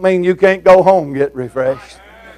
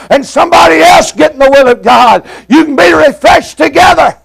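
fiery preaching; 5 semitones higher than usual. Acted. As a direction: drive it home to the congregation. Preaching at full force.

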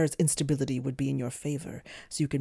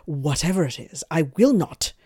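sneering; 2 semitones higher, 7 dB louder than usual. A narrator's reading. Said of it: "Shalot sneered".

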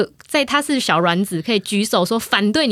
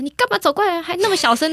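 mock scolding; 10 semitones higher than usual. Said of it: "他会骂你".

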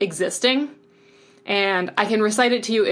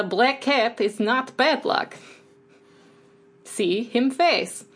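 put-on slow Russian-accented English, a bit higher; 4 semitones higher, much slower than usual. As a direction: drawl out the cat catchphrase in a Russian accent. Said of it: "one of the few accents I can kind of do".

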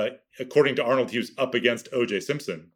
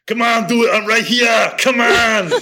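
high, loud Austrian action-hero shout; Schwarzenegger impression; 10 semitones higher, 6 dB louder than usual. Shown as a demonstration. Podcast guest shows his Arnold, shouting a taunt in Austrian accent. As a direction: bark it like Arnold in Predator, taunting. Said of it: "Schwarzenegger from Predator".

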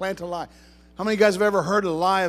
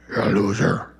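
much lower, hammed-up devil growl; mock devil voice; 12 semitones lower than usual. Preacher mimics the devil taunting a believer, hammed-up low voice. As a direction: drop low and play the devil for a laugh. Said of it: "he doesn't talk like that".

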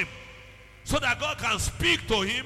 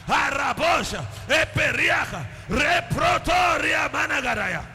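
loud and higher, rapid bursts of tongues; shouted fervent prayer; 4 semitones higher, 5 dB louder than usual. Acted. Pastor commanding dark voices silenced, shouting in tongues. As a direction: rebuke the unseen voices at full force.

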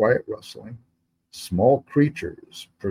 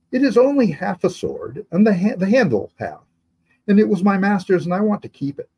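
put-on high, earnest boy's voice; pleading boy; 9 semitones higher than usual. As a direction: beg to keep his master's sword. Boy pleading to keep sword.

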